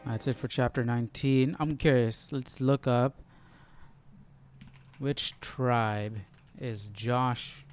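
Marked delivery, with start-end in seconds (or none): none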